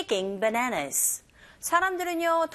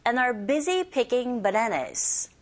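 slow whole-sentence model; very slow teacher model; slow model reading; much slower than usual. Shown as a demonstration. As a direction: read it out slowly for the class. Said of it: "thank you for sharing the sentence".

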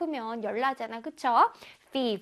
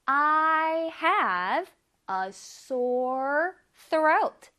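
very slow drill reading with rising end; slow repeat-after-me; 3 semitones higher, much slower than usual. Shown as a demonstration. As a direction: draw every word out for students to echo. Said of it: "시작!".

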